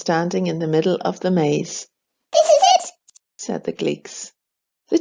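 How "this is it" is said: very high, fast little creature; squeaky creature voice. A narrator's reading. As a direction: chirp it out like a tiny forest creature. Gleeks speak.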